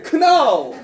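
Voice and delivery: 크나우, "know" said as three syllables, loud and laughing; joking mispronunciation. Shown as a demonstration. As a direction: blurt out 크나우 as a joke. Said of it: "절대로 안 잊어버리겠네". (크나우를 크게 웃으며 세 음절로)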